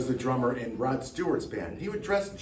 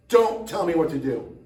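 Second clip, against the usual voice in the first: louder, higher mock protest; mock defiant outburst; 4 semitones higher, 6 dB louder than usual. Host refusing to be told what to do, mock defiant outburst. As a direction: raise your voice in playful defiance.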